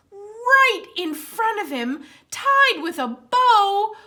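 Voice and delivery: lively storybook read-aloud; reading to children. Acted. Librarian reads Santa's Underwear aloud to children, animated. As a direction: read it brightly for the kids at story time.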